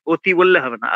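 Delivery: ordinary talk, nothing marked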